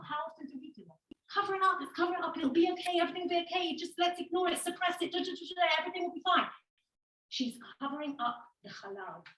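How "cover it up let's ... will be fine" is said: higher, softer, coaxing hush-it-up voice; mock soothing. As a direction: pat it away like soothing a child. Esther soothing, hushing it up.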